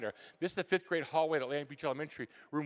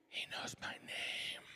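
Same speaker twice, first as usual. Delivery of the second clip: slow, breathless whisper of disbelief; awed whisper; 5 dB quieter, slower than usual. Retold or imitated. Kid stunned the teacher knows his name, slow whisper. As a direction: hush it like a secret wonder.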